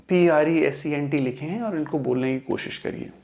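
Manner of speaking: ordinary talk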